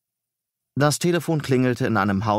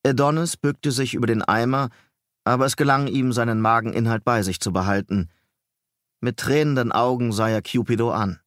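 ordinary delivery, nothing marked

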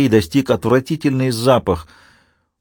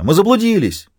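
shouting for help; 10 semitones higher than usual. Acted. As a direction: cry out as if lost. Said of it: "снова закричала она".

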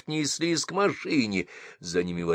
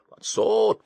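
grumbling, higher; 13 semitones higher than usual. Acted. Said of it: "ворчал Басилов".

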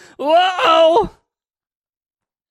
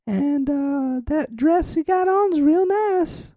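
higher put-on lady voice; man doing a woman's voice; 5 semitones higher than usual. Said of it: "lady voice?".

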